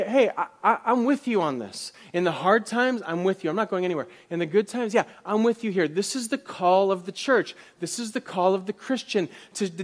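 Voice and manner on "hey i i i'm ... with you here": warm encouragement. Retold or imitated. Church voicing solidarity, laughing.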